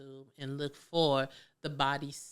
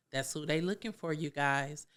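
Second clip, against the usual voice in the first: ordinary talk, nothing marked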